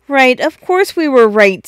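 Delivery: loud insistent; emphatic. Acted. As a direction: insist loudly. Bobby insists.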